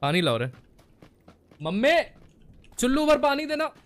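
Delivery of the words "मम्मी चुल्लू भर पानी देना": high, loud call for mum; pitched-up holler to mother. Real, not acted. Calls out to mother for water.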